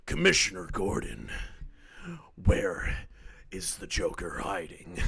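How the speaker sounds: low whispered Batman impression. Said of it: "the Batman villain voice".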